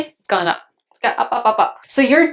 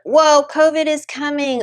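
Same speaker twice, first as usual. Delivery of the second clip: mock-alarmed, pitched higher; shocked gasp; 7 semitones higher than usual. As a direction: act out a panicked investor hearing the news. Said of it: "you're like".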